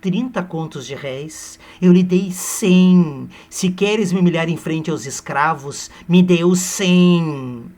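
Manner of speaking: very slow, loud, over-enunciated; haughty mock demand. Aurélia demanding the rest from Seixas, slow haughty. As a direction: lay each word down with contempt.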